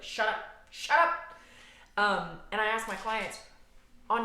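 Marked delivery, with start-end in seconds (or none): 0.0-1.1 loud, high, exasperated outburst; mock angry yell. Twelve-year-old at a bawling five-year-old, mock angry yell. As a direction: act out a kid yelling down a crying child.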